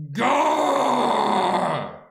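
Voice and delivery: high, very loud, long-held "gur"; emphatic syllable. Shown as a demonstration. Trying to add importance to gur, emphatic bellow. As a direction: stretch "gur" into a huge bellow. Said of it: "add emphasis, and say that".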